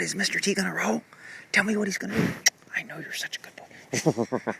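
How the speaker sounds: soft whisper, close and gentle; whispering to horse. Real, not acted. Whispering to Buddy so Tanner isn't disturbed, soft whisper. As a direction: hush it so the horses aren't disturbed.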